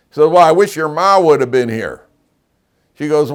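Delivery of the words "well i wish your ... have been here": loud, high mock lament; father's lament. Joking father to daughter, loud mock lament. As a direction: wail it like a comic dad.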